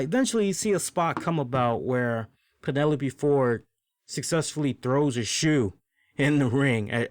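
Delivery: ordinary talk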